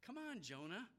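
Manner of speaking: high, scoffing "Really?" then a chiding "Come on"; mocking disbelief. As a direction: scoff at Jonah from a safe seat. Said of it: "sit in judgment and go".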